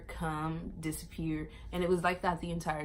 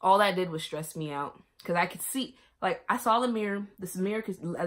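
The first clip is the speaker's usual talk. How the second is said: ordinary delivery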